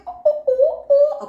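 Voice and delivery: very high, loud ooh ooh; excited hoots. Real, not acted. Reader gets carried away, hooting loud and high. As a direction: let the joy burst out in hoots. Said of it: "I'm getting too excited".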